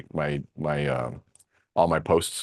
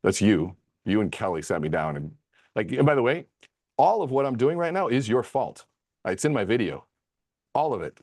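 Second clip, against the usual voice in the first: ordinary talk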